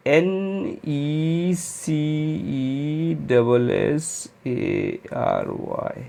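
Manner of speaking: very slow, letter by letter; spelling out the right answer slowly. Shown as a demonstration. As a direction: draw out each letter for the class to copy. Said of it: "तो fourth option आपका ठीक हो गया".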